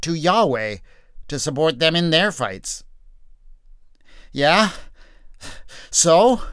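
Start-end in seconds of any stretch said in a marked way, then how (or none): 4.3-6.4 raised, unimpressed so what; dismissive shrug. Acted. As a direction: toss it off, unbothered.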